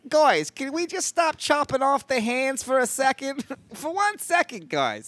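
hammed-up Yiddish-flavoured plea.

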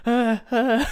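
high, loud flustered sounds; panicked dithering; helpless stammer. Retold or imitated. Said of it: "Og jeg bare".

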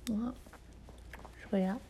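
ordinary talk, nothing marked

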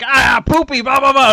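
put-on high, loud silly kid chanting poopy; hyper kid. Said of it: "and he's like".

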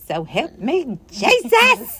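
mock-mad outburst leaping high on the last word; mimicked relative. Woman mimicking a relative when she gets mad, exasperated. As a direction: imitate an exasperated relative, building to a high cry. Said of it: "I have a relative that I mimic".

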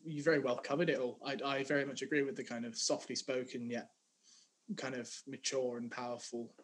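ordinary delivery

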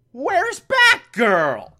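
high, drawn-out mock shock; hammed-up cry of surprise; sudden alarm. Batman notices Batgirl has gone, exaggerated alarm.

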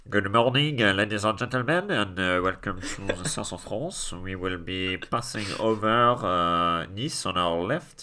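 airline captain voice over the intercom; captain announcement. Retold or imitated. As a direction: read it like a pilot greeting passengers. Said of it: "maybe he's the captain who's sort of".

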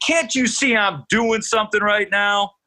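high, strained irritated yell; angry yell. Retold or imitated. Barry Bonds yelling at the pestering reporter. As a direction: bark it back as if interrupted at the weights. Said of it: "then he yells".

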